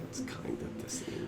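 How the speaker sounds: hushed unvoiced whisper. Real, not acted. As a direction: whisper it as an aside. Aside remark while others talk.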